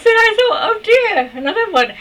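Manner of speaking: exasperated. Retold or imitated. She recalls meeting yet another troubled man, loud mock despair. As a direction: throw up your hands in mock despair.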